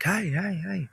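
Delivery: soft, high-pitched attempt to comfort; imitated soothing. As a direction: gently hush a distressed friend, retold. Soothing a friend in tears.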